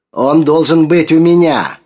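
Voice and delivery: high, loud, possessive insistence; demanding cry. A narrator's reading. Sergei demands the figurine from Yulka, crying out.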